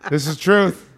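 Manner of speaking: giggling, emphatic agreement; laughing agreement. Real, not acted. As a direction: agree while still cracking up. Laughing agreement after outburst.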